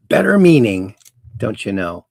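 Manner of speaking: ordinary delivery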